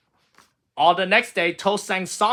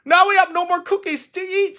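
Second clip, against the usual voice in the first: sad; 6 dB louder than usual. A narrator's reading. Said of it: "said Toad sadly".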